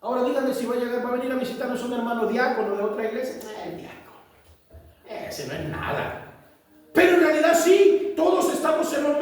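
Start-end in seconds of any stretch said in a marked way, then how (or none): 3.5-6.2 slow, breathy scorn; mock disdain for a visiting deacon; dismissive whisper. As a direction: sniff at the deacon under your breath. Voiced dismissive member.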